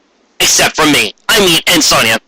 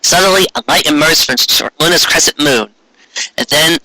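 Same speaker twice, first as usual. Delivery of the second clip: usual speaking voice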